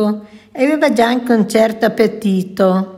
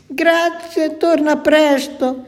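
much higher, a frightened yet glad call; trembling cry; 6 semitones higher, slower than usual. A narrator's reading. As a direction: cry out, half scared and half relieved. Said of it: "gridò Ricky tutto tremante".

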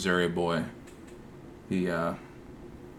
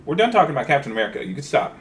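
loud, fast, much higher yell across the room; shouting at Duke; 7 semitones higher, 7 dB louder than usual. Real, not acted. Host yells at Duke to quit it, loud fast shout.

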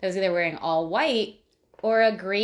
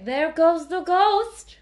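higher, louder mock announcement of the ghost; hammed-up call, slow and raised; mock spooky quote; 8 semitones higher, 6 dB louder, slower than usual. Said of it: "the man said he dared to say".

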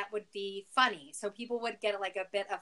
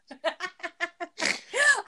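snorting laugh; 9 semitones higher, 5 dB louder than usual. Real, not acted. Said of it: "did you just snort?".

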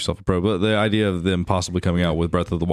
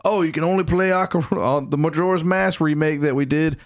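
higher-pitched mock corporate announcement; mocking company voice; 6 semitones higher than usual. Nintendo explaining a console-exclusive remake, mocking imitation. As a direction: parody the company's breezy excuse.

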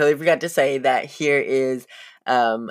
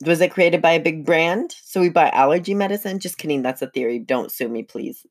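ordinary delivery

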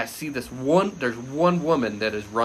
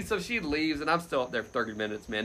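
ordinary talk, nothing marked

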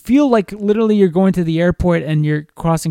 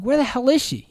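annoyed; 8 semitones higher, 4 dB quieter than usual. Retold or imitated. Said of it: "I'm like".

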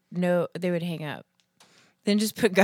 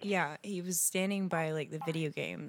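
hushed; 8 dB quieter than usual. Acted. Prank caller speaking hushed.

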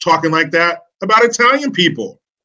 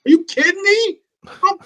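incredulous; 14 semitones higher than usual. Real, not acted. Ranting about unheated Chicago classrooms.

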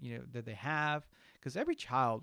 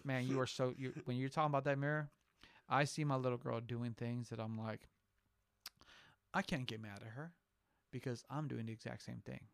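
ordinary talk

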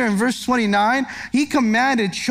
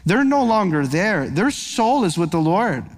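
tender comfort; 4 semitones lower than usual. Real, not acted. Preacher comforting mourners, low tender reassurance. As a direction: comfort the grieving gently.

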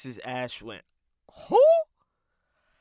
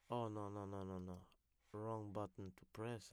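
ordinary talk

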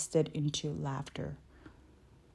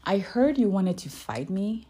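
huff; 4 semitones higher, 6 dB louder than usual. A narrator's reading. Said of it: "she huffed".

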